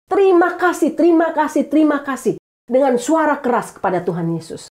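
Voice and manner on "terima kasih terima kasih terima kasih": healed leper crying thanks to Jesus, high; grateful shout. Retold or imitated. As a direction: call out thanks, pitched up high and fading down. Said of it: "teriakan dia lebih keras lagi".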